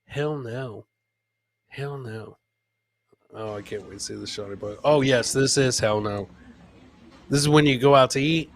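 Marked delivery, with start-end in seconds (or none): none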